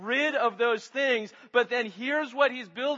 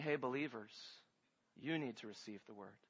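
very soft and much lower; hushed address; 11 semitones lower, 16 dB quieter, faster than usual. Acted. Preacher voices James speaking to believers, hushed and low. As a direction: drop to a low, intimate murmur. What James says, contrasted softly.

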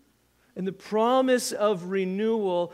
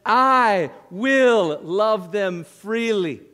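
"will" stressed, very slow and louder; slow emphatic quote; 8 dB louder, much slower than usual. Shown as a demonstration. Preacher reading verse four, slow and emphatic. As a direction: draw out "I will love them freely", leaning on "will". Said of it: "Verse four again".

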